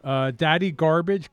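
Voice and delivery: ordinary talk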